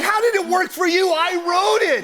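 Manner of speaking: high, indignant half-shout; pitched-way-up comic yell of injustice; mock outrage. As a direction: protest in hammed-up disbelief. Retold outrage after being upstaged.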